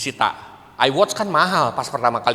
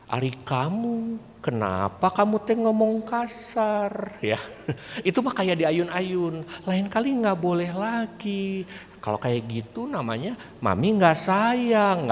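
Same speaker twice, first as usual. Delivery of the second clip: soft, slow, sing-song coaxing; too gentle; 3 semitones higher, 7 dB quieter, slower than usual. Shown as a demonstration. Parent scolding a child far too gently, soft and slow. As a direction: lull the child like rocking a cradle. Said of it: "Terlalu lembut kalau itu mah".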